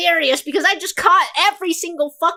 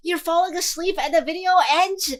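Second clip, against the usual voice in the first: ordinary talk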